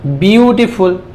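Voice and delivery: "Beautiful" drawn out as byoo-ti-ful; slow sounded-out "beautiful"; broken into parts. Shown as a demonstration. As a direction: stretch each syllable of "beautiful". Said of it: "इसको कैसे तोड़ेंगे?".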